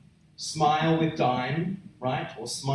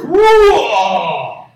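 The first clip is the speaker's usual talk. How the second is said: huge shouted roar, high and loud; lion roar; 6 semitones higher, 14 dB louder than usual. Shown as a demonstration. Preacher roaring like a lion at the congregation, huge shout. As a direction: let out a full lion's roar. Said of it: "sounds like the roar of a lion".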